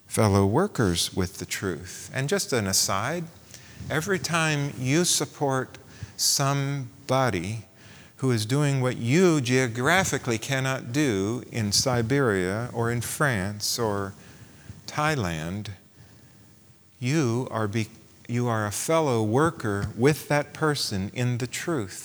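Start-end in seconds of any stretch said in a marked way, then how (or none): none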